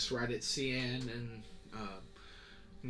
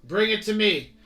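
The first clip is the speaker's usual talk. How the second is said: much higher, loud barked orders to the men; captain's order; 11 semitones higher, 14 dB louder than usual. Acted. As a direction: command the search, firm.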